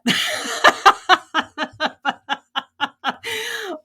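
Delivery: long, high burst of laughter; hearty laugh. Real, not acted. Laughing about neighbors who don't like to hear that, heartily amused. Laughs at her own retort.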